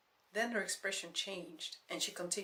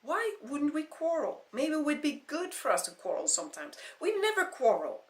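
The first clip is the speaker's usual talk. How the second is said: loud, high upset rant; upset shouting; 9 semitones higher, 9 dB louder than usual. Acted. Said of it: "so upset".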